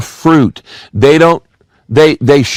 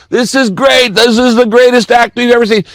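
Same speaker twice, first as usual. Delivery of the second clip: high-pitched, thrilled onlooker; excited crowd; 10 semitones higher than usual. Acted. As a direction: exclaim like an amazed crowd, pitched way up. Thrilled crowd cheering in the story.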